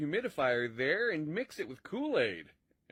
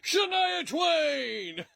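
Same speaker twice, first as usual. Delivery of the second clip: sudden shout; 9 semitones higher, 5 dB louder, much slower than usual. Acted. Random non sequitur shouted to the viewers, loud and slow. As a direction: bellow the name out of nowhere.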